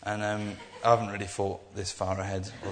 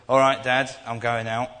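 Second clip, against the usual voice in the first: put-on teen calling out on the way out the door; teenager voice; 2 semitones higher, 9 dB louder than usual. Teenager telling dad he's going out, loudly.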